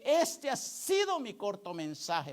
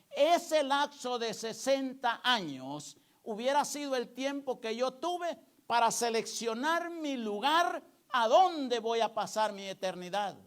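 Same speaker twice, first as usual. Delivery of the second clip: usual speaking voice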